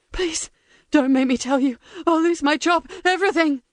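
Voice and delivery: high, frantic begging; desperate pleading. Acted. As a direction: beg for your life. Prisoner begs.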